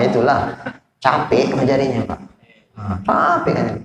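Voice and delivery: ordinary delivery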